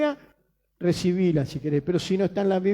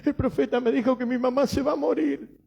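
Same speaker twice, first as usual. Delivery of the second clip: higher-pitched mock-tearful plea; imitando tearful churchgoer; 4 semitones higher than usual. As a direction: imitate a weeping woman telling her pastor. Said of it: "Vino una hermana llorando". (imitando un lloriqueo agudo)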